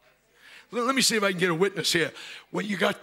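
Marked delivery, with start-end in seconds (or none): 0.0-0.7 quick, barely audible whisper. Acted. God quietly getting someone's attention, whispering. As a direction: whisper it as a private request. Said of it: "supernatural is no more than".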